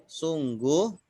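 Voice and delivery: usual speaking voice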